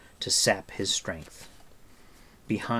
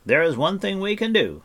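high, raised, urgent call; crying out; 11 semitones higher, 7 dB louder than usual. A narrator's reading. Bard rallying his companions to act, crying out. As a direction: cry it out high and loud. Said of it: "he cried".

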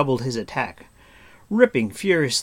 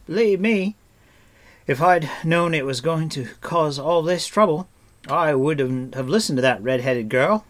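raised, loud earnest protest; lightly voiced stranger; 6 semitones higher, 6 dB louder than usual. A narrator's reading. Stranger assuring Taran he meant no trouble, mildly flustered. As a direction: plead innocence with a touch of fluster.

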